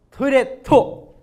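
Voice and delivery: slow, high, loud cue; calling out the last heading. Real, not acted. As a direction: stretch it out as the final call. Leading congregation through last heading. (높고 길게 선창하듯)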